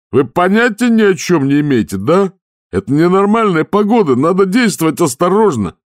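high, loud, exasperated shout; frantic outrage. A narrator's reading. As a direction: rant at him like he's insane. Skipper raging at Vimes. (шкипер в ярости кричит на Ваймса)

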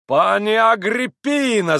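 high, loud drawn-out cry of address; shouted. A narrator's reading. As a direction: cry out the name, stretching it. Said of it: "закричал пан".

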